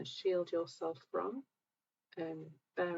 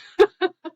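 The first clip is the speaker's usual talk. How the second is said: high, much louder burst of laughter; 13 semitones higher, 20 dB louder than usual. Real, not acted. Joking about her fiancé, bursting into loud high laughter. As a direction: laugh hard at her own joke.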